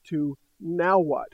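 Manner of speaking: ordinary talk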